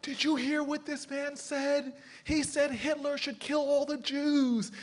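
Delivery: very high, drawn-out mock scream; high wailing imitation of public outrage; retold screaming.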